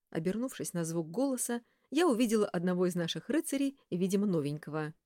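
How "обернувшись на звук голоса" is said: ordinary delivery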